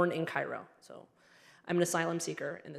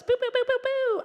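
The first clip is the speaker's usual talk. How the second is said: very high, loud boo-boo beat; party bus chant; 6 dB louder than usual. Acted. Comedian imitating Beirut party bus, high hammed-up chant. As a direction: bounce like club music.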